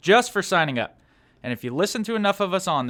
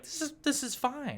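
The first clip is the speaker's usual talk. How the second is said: mock calm; 11 semitones higher, 8 dB quieter than usual. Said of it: "I was like".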